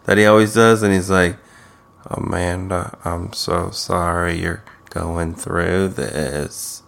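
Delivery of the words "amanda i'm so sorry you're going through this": low, slow, hushed mock sympathy; soft, low, drawn-out consoling voice; soft pouty comforting. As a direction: imitate a man cooing condolences through a pout. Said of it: "soft, like, pouty, comforting voice".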